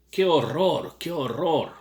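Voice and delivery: loud, high "qué horror" in mock distress; distressed cry. A narrator's reading. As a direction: cry it out, upset and shocked. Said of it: "Pero Lenina estaba llorando".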